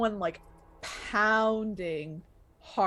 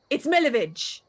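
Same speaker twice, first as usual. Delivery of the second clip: louder, higher, indignant correction; pissed-off teenage boy; 5 semitones higher, 5 dB louder than usual. Acted. Angry boy correcting his mangled name, indignant. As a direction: snap the name back angrily. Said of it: "He seems pissed".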